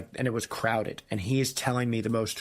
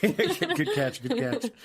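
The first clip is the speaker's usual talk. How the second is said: laughing; 9 semitones higher, much slower than usual. Real, not acted. Laughing reply.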